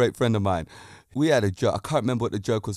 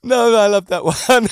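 higher, louder, gushing with glee; delighted; 7 semitones higher, 7 dB louder than usual. Real, not acted. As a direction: still buzzing from the joke. Still laughing after joke.

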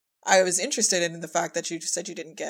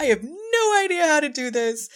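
mock helpless; 9 semitones higher, 5 dB louder than usual. Retelling own panic at remote recording.

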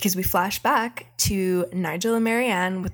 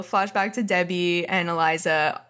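ordinary delivery

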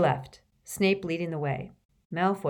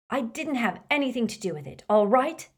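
put-on defensive teen; 6 semitones higher, 4 dB louder than usual. Malfoy defends himself to Snape.

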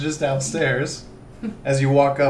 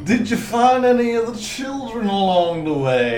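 slurred, raised southern voice; slurry magistrate; 6 semitones higher, 3 dB louder than usual. Acted. Magistrate asking adventurers about missing children, slurry. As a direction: play a slurring southern official. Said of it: "a little more slurry with his words".

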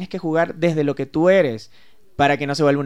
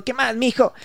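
casual friendly greeting; 8 semitones higher than usual. Shown as a demonstration. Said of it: "A veces le digo".

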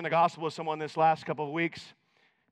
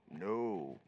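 stubborn churchgoer; 11 semitones lower, 11 dB quieter than usual. Retold or imitated. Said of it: "and he goes".

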